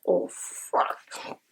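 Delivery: whispered oh fuck. Retold or imitated. Shocked reaction to the dream.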